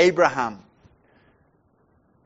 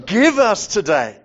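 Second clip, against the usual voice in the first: louder, firm "Give us today" with weight on "Give"; strong demanding; 3 semitones higher, 5 dB louder than usual. Shown as a demonstration. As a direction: ask as though demanding. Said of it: "the sense there is very strong".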